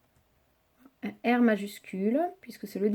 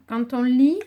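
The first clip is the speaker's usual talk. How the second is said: ordinary talk, nothing marked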